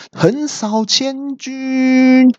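very high, drawn-out battle cry; stretched martial-arts war cry; shouted move name. A narrator's reading. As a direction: bellow the attack like a kung fu villain. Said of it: "老三就大喊了一声说".

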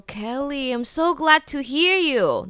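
high, slow, drawn-out delight; a light take on a pleased friend; imitating a glad friend. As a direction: play the coworker gushing at the call. Coworker answering the phone.

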